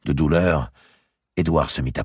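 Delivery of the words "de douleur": ordinary delivery, nothing marked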